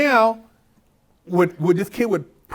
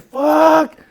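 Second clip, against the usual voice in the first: frustrated curse; 6 semitones higher, 5 dB louder than usual. Retold or imitated. Retells dismay in court.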